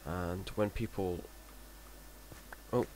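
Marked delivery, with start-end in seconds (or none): none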